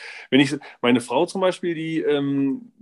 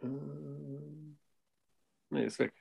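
fading out, thought lost; trailing off; 15 dB quieter, much slower than usual. Real, not acted. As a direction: voice drops away, giving up. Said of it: "Ich hab grad den Faden verloren". (leise auslaufend, Gedanke verloren)

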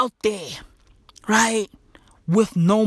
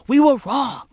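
usual speaking voice

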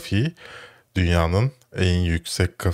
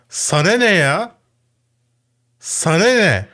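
high-pitched, exasperated yell; cathartic shout; 11 semitones higher, 4 dB louder than usual. Shown as a demonstration. Host shows listeners how to vent, cathartic high shout. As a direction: yell it out to let off steam. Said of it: "diye bağırınca çok rahatlıyorum".

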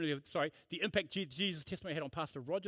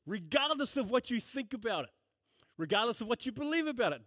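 preaching; 3 semitones higher than usual. Acted. Pastor proclaiming Christ to his congregation, fervent preaching. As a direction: preach it with full conviction from the pulpit.

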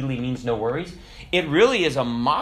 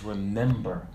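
fading out; 5 semitones lower, 7 dB quieter than usual. Acted. Ghost father fading from the sky, low receding voice. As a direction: let the ghostly voice sink away. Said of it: "as he fades out, he says".

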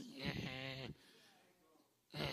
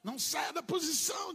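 preacher's cry; 7 semitones higher, 3 dB louder than usual. Real, not acted. Preacher exhorting congregation loudly.

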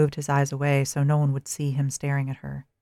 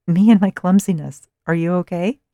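put-on thick American accent, bright and loud; lightly voiced American tourist girl; 4 semitones higher, 10 dB louder than usual. A narrator's reading. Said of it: "in her thick American accent".

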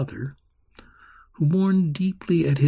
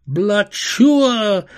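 high, loud explosion call; firework boom; 6 semitones higher, 7 dB louder than usual. Acted. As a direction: burst it out like fireworks. Fireworks in her mind.

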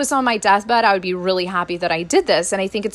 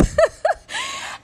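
high laugh; 4 dB quieter than usual. Real, not acted. Own laugh at mom's reaction.

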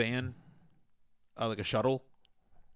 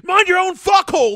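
very loud, high acted scream; freaking out; 16 dB louder than usual. Imitating the dog owner freaking out on the guy, screaming. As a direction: explode in a full-force yell. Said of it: "fucking freaks out on the guy".

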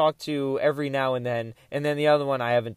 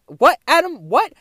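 high, loud retold bewilderment; bewildered; 11 semitones higher, 7 dB louder than usual. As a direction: protest in baffled, raised-pitch bursts. Retold protest at friend's accusation.

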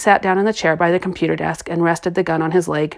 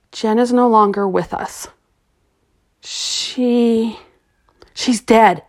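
harsh, grieving; 3 semitones higher, slower than usual. A narrator's reading. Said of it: "the man said harshly".

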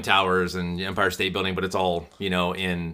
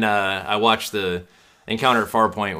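ordinary talk, nothing marked

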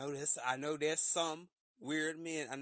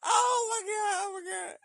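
mock squeal; 10 dB louder than usual. Squealing fan continues.

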